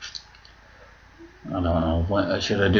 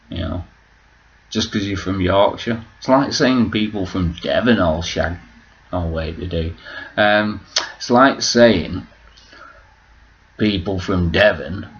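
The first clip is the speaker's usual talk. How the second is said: normal talking voice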